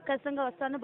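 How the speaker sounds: usual speaking voice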